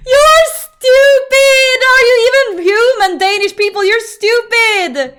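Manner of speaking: high, very loud incredulous shout; laughing mock outrage. Real, not acted. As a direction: yell at them through hysterics. Mock outrage at Danish pronunciation.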